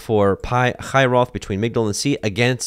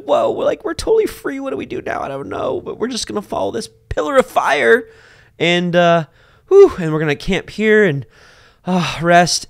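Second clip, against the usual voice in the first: high, loud giddy excitement; excited freed people; 12 semitones higher, 4 dB louder than usual. Retold or imitated. Israelites just freed from Egypt, giddy and excited. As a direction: bubble over like freed slaves. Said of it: "excited 'cause you're like".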